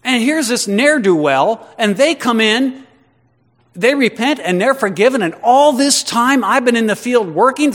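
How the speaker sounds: high, loud, self-righteous protest; aggrieved churchgoer protesting unfairness; indignant complaint. Retold or imitated. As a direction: whine it like you've been cheated. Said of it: "Because you think".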